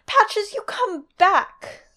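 high-pitched, drawn-out shout; Shireen shouting. Acted. Girl shouting after the fool she is chasing. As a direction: call a runaway playmate back, stretching it out. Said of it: "she was shouting after him".

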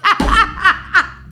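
high burst of laughter; bursting out laughing; loud laugh. Real, not acted. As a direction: laugh out hard. Big laugh at joke.

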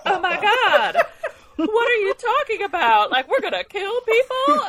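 loud, high shocked cry; mock shocked outburst. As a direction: blurt it in sudden hammed-up horror. Mocking Fitz's shocked realization.

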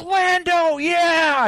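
high, gleeful cheer; excited cheer. Retold or imitated. Host retelling his joy at seeing Lando, high cheer. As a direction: cheer like a fan spotting an old friend. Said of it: "I was like".

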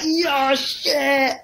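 mock drunk guy in the crowd; drunk heckler. Drunk audience member heckling the comics, slurred. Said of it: "he was like".

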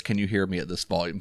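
quoted in a low, quiet voice. Retold or imitated. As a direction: drop your voice, quoting a man who won't raise it. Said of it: "he lowers his voice".

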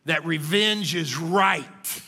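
each word slow and weighted; slow deliberate emphasis on revenge is right; spelled out slowly. Shown as a demonstration.

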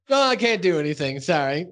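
a hint of a flamboyant Big Al; mild lilting apology; a light take on Big Al; lightly voiced Big Al. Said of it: "But then Big Al goes".

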